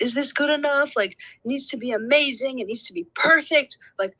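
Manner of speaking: high, anxious self-pressure, re-enacted; retold self-criticism. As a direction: act out mildly panicked perfectionism. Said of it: "I was being very mean to myself".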